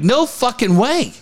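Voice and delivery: retold refusal, high and drawn out; mock-incredulous refusal, stretched slow and high; emphatic refusal. Telling how he and Dave balked, incredulous refusal.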